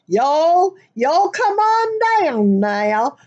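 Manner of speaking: y'all come on down now, high, slow and drawled, laughing; a big, laughing Southern welcome call; hammed-up Southern accent. Shown as a demonstration. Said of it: "that is a Southernism".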